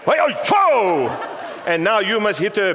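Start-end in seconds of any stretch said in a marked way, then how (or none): 0.0-1.1 high, slow, laughing whoop; hey oh cho, high and laughing; excited whoop. Real, not acted. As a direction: cheer the slap like a ringside fan. Reacting to the mock slap.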